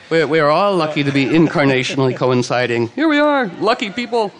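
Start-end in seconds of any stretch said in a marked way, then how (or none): 2.9-4.3 much higher, singsong; a giddy, lilting cheer; playful delight. Real, not acted. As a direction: sing it out gleefully. Playful reply to praise.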